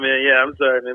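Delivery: ordinary talk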